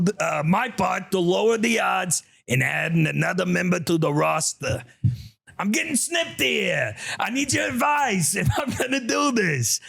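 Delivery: high, shouty New Yorker; New York accent. Acted. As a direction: read it as a hollering cartoon New Yorker. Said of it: "gonna have to read this in an accent".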